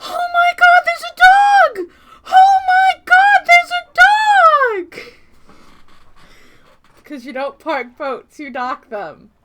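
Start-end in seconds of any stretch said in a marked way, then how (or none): 0.1-1.9 very high, loud squeal; ecstatic scream. Real, not acted. Streamer spotting a dog in the game, shrieking with joy. 2.3-5.1 high, slow, loud squeal into laughter; laughing squeal. Real, not acted. Streamer repeating the dog discovery, squealing and laughing.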